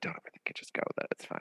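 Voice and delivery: quiet, casual dismissal in someone else's words; offhand imitation. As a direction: toss it off under the breath. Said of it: "someone just be like".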